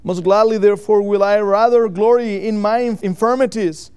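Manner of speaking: loud, emphatic verse reading; scripture reading. Real, not acted. Preacher reading scripture to the congregation, loud and emphatic. Reads scripture aloud with force.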